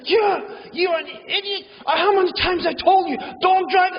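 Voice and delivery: a retold father losing his temper; angry father. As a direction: blow up at the kid like a furious dad. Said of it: "he loses it".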